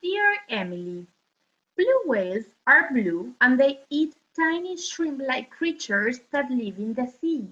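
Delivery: normal talking voice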